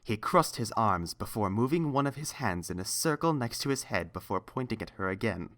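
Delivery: usual speaking voice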